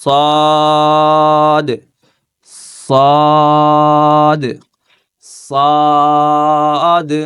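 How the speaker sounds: loud drawn-out Arabic صاد; heavy sad. Shown as a demonstration. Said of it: "বাতাসের মোটাটা খেয়াল করেন".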